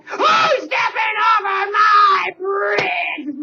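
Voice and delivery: slow, loud troll bellow; troll roar. Acted. Troll roaring at the goat on his bridge, slow and loud. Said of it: "roared the troll".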